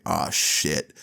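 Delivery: low, soft, sinking groan of a curse; dismayed. Retold or imitated. Two friends realising the lie will backfire, quietly dismayed. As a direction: mutedly imitate a sinking feeling. Boys' dismayed reaction, retold.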